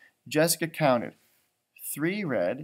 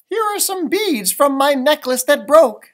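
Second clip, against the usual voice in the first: higher and louder, a hint of eager girl; lightly voiced girl Laura; 9 semitones higher, 14 dB louder than usual. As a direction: hand them over brightly as a little girl. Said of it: "said Laura".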